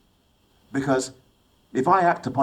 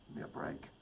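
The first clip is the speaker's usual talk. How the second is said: whispered aside; 8 semitones lower, 19 dB quieter than usual. Real, not acted. Scornful aside after her tears.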